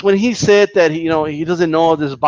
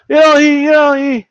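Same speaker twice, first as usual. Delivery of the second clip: higher, louder mock fighter voice; mocking Ortiz; 8 semitones higher, 4 dB louder than usual. Mocking the fighter to the stream, higher and louder. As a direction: sneer his fake praise of Maidana. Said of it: "And then here comes the but".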